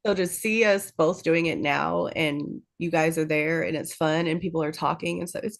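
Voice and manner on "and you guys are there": usual speaking voice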